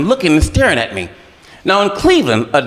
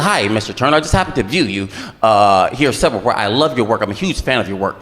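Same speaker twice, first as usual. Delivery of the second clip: low, smooth producer voice; 8 semitones lower than usual. Retold or imitated. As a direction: lower the voice for the slick producer. Producer voiced low in told story.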